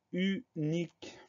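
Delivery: UNIQUE split slowly as u, nique; syllable by syllable. Shown as a demonstration. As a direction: dictate "unique" in separate, drawn-out syllables. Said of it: "Première syllabe".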